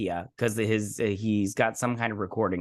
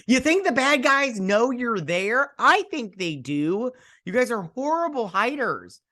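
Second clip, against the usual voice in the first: very high, drawn-out mockery; mocking disbelief; 11 semitones higher than usual. Real, not acted. Viewer mocks the hiders in the show, high incredulous voice. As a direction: scold them in a high, slow, incredulous voice. Mocking characters hiding in empty lot.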